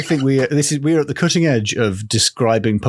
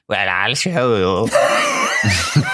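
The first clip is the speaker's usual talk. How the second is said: slow creepy voice; 2 semitones lower, much slower than usual. Acted. Kids ask about the hut.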